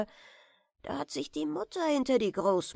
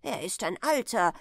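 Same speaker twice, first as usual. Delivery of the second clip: ordinary delivery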